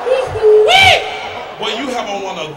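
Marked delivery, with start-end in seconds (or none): 0.1-1.2 slow high laughing holler; laughing whoop. Real, not acted. Comic spotting a loud shirt in the crowd, whooping with laughter. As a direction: let out a big delighted whoop. Heckling audience member's shirt.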